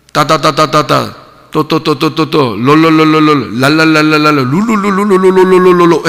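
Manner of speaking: rapid babbling nonsense syllables; mock speaking in tongues. Preacher mocking what people imagine tongues sound like, babbling. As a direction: parody ecstatic tongues gibberish. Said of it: "랄랄랄라 이렇게 해야 되고".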